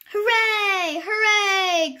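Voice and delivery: high, loud joyful cheer. Acted. Dolls and toys cheer the little engine, loud and high. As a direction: celebrate at the top.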